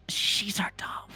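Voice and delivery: whispered, secretive pixie voice. Acted. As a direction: lean in and whisper it. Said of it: "leans in and whispers".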